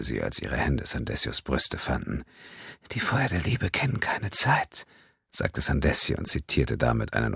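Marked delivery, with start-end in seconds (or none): 2.9-4.9 whispering. Acted. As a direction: breathe it like a love poem. Lover whispers a poetic line.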